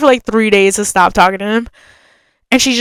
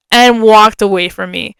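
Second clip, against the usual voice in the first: ordinary talk, nothing marked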